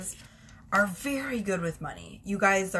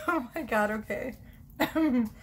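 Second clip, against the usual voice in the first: ordinary talk, nothing marked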